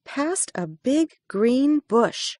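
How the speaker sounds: ordinary delivery, nothing marked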